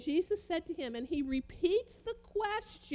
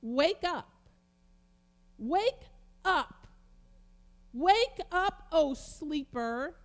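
loud, raised wake-up call; rousing call; 3 semitones higher, 5 dB louder, much slower than usual. Retold or imitated. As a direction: call out to rouse a sleeper. Preacher voices a wake-up call.

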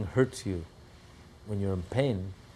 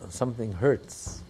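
ordinary delivery

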